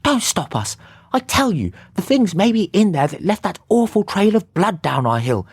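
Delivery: high, pleading sister voice; desperate to save her brother; distressed pleading. A narrator's reading. Sister begs detective not to stop them, high and pleading. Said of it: "In her distress".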